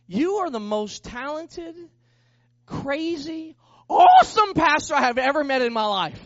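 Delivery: high, drawn-out build to a loud outburst; amazed praise. Retold or imitated. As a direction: space out the first words, then burst out shouting. Retold amazed praise from piano man.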